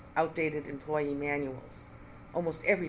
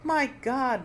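a much higher, louder gasp of shock; cry out; 9 semitones higher, 5 dB louder than usual. Acted. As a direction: cry out in staged alarm. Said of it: "Nina cried".

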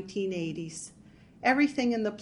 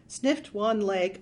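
usual speaking voice

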